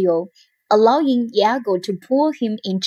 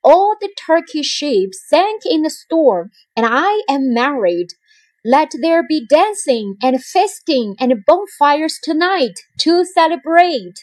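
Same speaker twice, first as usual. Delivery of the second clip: higher, louder proclamation; announced; 6 semitones higher, 4 dB louder than usual. A narrator's reading. Othello announces good news to his troops, loud and high. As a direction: proclaim it to the whole army. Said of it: "he announced".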